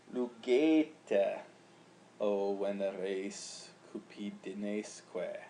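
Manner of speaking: slow recited Latin; Latin verse reading. Shown as a demonstration. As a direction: read the Latin line aloud slowly. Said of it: "the next new feature is in line eighty-eight".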